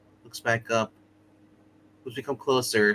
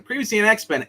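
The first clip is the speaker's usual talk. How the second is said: raised, louder mock TV recap voice; X-Men recap announcer; 10 semitones higher, 5 dB louder than usual. Player likens skipped summaries to watching X-Men, mock recap announcer. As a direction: announce it like an episode opener. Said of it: "it's like watching X-Men".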